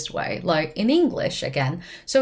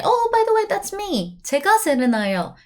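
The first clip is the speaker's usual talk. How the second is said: high, louder Oh by the way, then quick 제가; surprised pop-in; 12 semitones higher, 4 dB louder, much faster than usual. Shown as a demonstration. Someone is talking about you and you jump in, bright. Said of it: "maybe you can say".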